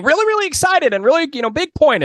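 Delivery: an excited high big-point voice. Shown as a demonstration. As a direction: voice leaps high on really excited. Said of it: "the range of the voice".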